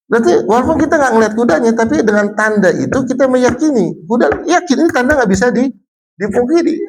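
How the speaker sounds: high, animated insistence; excited. Real, not acted. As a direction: push the point with rising excitement. Animated argument, pitch jumps.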